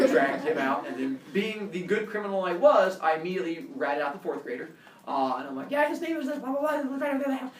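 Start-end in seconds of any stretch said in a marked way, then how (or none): 5.7-7.5 eager snitch. Retold or imitated. Eagerly ratting out friend.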